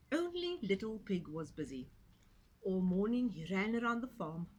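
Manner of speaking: plain reading voice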